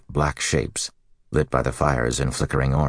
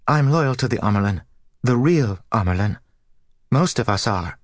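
passionate; 9 semitones higher, 3 dB louder than usual. Acted. Novice pleads fervently.

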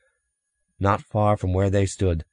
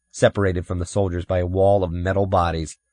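plain reading voice

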